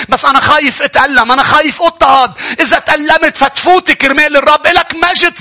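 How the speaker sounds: high, loud impassioned preaching; rising fervent sermon; fiery preaching. Acted. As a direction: preach it at full force to the crowd. Preaching at full force, rising.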